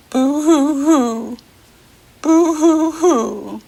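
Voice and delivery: high, loud, slow hammed-up boo-hoo-hoo; mock baby crying. Teacher acts a crying baby for children, mock wail. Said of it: "This one says".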